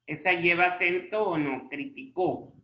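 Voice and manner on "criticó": "Criticó" as cri-ti-CÓ; stress on the final ó. Shown as a demonstration. Teacher asking if it has an accent, "Criticó" stressed on CÓ. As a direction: put the weight on the last syllable of "Criticó". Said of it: "Lleva en la O".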